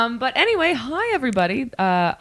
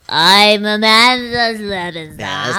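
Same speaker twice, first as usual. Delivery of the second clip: slow, louder, worn-out host voice; tired radio DJ; 2 semitones higher, 5 dB louder, much slower than usual. Pretend. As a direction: drag it out like an exhausted DJ. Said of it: "she's like".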